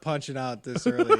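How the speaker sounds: ordinary talk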